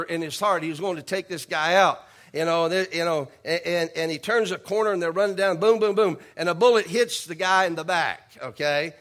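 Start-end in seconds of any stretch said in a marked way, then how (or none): 5.6-6.2 gunshot booms. Retold or imitated. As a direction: boom boom, loud and punchy. Gunshots in chase story.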